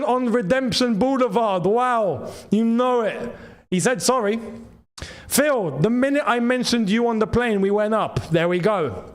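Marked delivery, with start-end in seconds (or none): none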